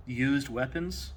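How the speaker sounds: ordinary talk, nothing marked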